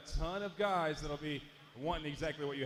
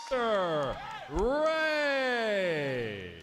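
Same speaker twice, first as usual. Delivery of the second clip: high, slow, loud drawn-out introduction; ring announcer; 7 semitones higher, 4 dB louder, much slower than usual. Acted. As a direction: hype the champion to the arena. Announcer presents champion to crowd.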